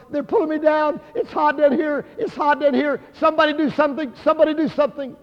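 high, urgent cries for help; screaming retelling. Retold or imitated. Dying brother begging bystanders for help, retold as high screaming cries. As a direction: imitate a desperate man crying out, pitched high. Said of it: "screaming to the top of his lungs".

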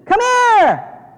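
high, loud mock come-on; big, high-pitched beckoning shout; hawker's call. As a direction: holler it out like luring in a crowd. Church mockingly calling people in.

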